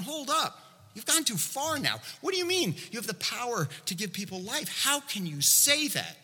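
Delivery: high-pitched, incredulous protest; affronted challenge, pitched well up; acted indignant leaders. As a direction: demand an explanation in mock outrage. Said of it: "the religious leaders are looking at Jesus".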